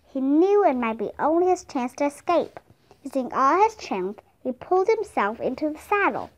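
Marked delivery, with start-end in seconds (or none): none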